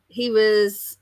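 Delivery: usual speaking voice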